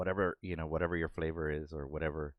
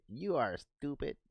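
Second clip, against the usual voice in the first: disdainful female cat; 10 semitones higher, slower than usual. Retold or imitated. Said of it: "the female cat's like".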